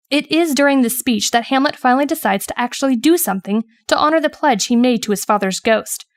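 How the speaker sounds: normal talking voice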